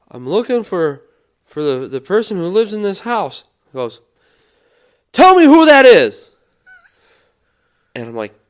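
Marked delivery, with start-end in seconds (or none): 0.1-3.4 higher, louder, hesitant explanation; nervous stranger. Retold or imitated. Stranger explains himself to homeowner, nervously pitched up. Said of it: "the dude just goes". 5.1-6.3 very high, full-volume holler; small-town porch yell, demanding an answer; Fred's shouted demand. Retold or imitated. Fred interrogates stranger at the house, shouting. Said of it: "of small town folk right now".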